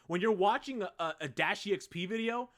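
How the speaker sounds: usual speaking voice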